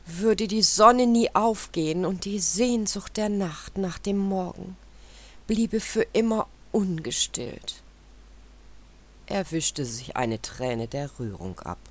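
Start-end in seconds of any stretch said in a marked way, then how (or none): 0.0-7.8 proud rooster. A narrator's reading. Rooster boasting, moved to tears.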